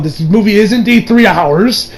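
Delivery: ordinary delivery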